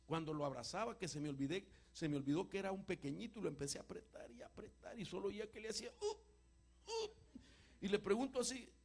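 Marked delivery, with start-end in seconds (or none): 6.0-7.1 high, tight little whimper as the hug squeezes; father mimics his toddler's squeezed whimpers; squeezed child's whimper. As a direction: imitate a small child's strained "uh" in a too-tight hug.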